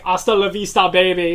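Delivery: put-on action-hero one-liner with an Austrian accent; Arnold's Austrian accent. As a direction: deliver a catchphrase in an imitated Austrian accent. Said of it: "when he said it with that accent".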